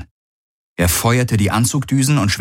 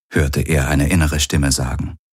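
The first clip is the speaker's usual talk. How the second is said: ordinary delivery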